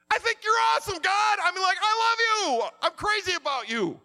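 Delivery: loud and high, praising God at full force; preacher shouting his love to God; fervent shout. Real, not acted. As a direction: cry it out to God with all your heart.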